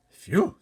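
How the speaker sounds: big, high, loud sigh of relief; loud relieved phew. A narrator's reading. Giant puffing out a loud phew of relief. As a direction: blow out a huge phew. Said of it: "said the B F G".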